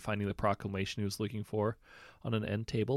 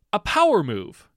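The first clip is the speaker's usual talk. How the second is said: much higher and louder, sudden realization; excited gasp; 12 semitones higher, 14 dB louder than usual. A narrator's reading. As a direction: blurt it out as the idea hits. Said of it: "he gasped".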